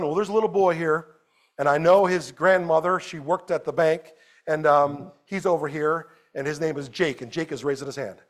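ordinary delivery

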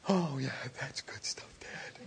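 put-on whispered small child; relieved child whispering to dad. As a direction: breathe it out like a relieved kid. Child answers after mercy.

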